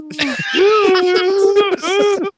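shrill cackle after the rant; a squealing burst of laughter; high squealing laugh. Pretend. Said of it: "Stop doing the sound noise".

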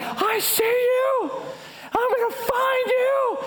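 comic, very high drawn-out holler; high-pitched mad threat. Retold or imitated. Soaked, mad man calls to fleeing culprits, high-pitched. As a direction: call it out way up high, stretching the words. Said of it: "My voice getting higher and higher".

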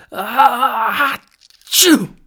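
very high, loud explosive sneeze; huge sneeze. A narrator's reading. Dragon sneezing over the village, explosive and loud. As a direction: let the giant sneeze blast out. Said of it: "The sneeze blasted from the dragon".

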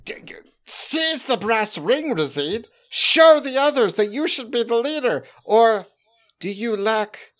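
buzzword-laden boss pitch; corporate pitch. Acted. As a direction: sell it like a manager to an underling. Corporate goblin pitches to orc.

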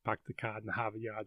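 quiet, low stab at Boston accent; lightly attempted Boston accent. Shown as a demonstration. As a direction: try out a Boston accent under your breath. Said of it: "struggles to maintain a Boston accent".

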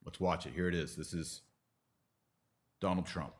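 normal talking voice

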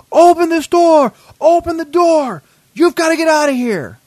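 high, loud, drawn-out holler; door-pounding yell. Retold or imitated. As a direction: yell it slowly through a door as you pound. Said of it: "A banging on the door. I'm talking a full-on force".

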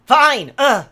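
shouted in exasperation; loud exasperated. Acted. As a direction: bark it, fed up. Acted sister quarrel scene.

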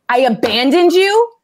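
indignant venting. Retold or imitated. Venting to a girlfriend on Marco Polo, indignant disbelief. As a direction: vent it to a friend in disbelief. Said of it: "this is a Marco Polo to a friend".

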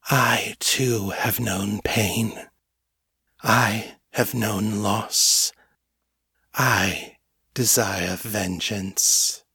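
very slow, with long solemn pauses; quiet, gentle. A narrator's reading. Ancient being shares its own loss with captive, gently. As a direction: confide it slowly and calmly, word by word. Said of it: "its quiet, gentle tones".

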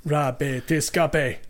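drawn-out, savouring each word; slow dramatic echo. Acted. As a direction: stretch the title out slowly. Slow echo of the game title.

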